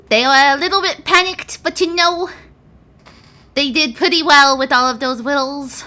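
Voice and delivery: a tiny pseudo dragon speaking up; small creature voice. Acted. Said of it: "As you all hear".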